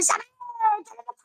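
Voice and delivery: high squeaky shout; frantic mother voice. Retold or imitated. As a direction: screech it high. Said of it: "what she wanted to do to Sammy".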